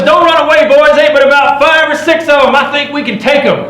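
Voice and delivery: high, full-voiced holler across a distance; yelling for help. Trapper in trouble yelling to his fleeing companions for help. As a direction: call out loud and high to far-off men. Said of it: "I just yell up at 'em".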